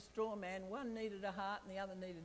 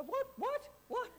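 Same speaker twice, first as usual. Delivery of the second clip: sudden high startled what, what, what; jolted awake and confused, calling out; half-asleep wife, caught off guard, asking high and loud; 14 semitones higher, 5 dB louder than usual. Retold or imitated.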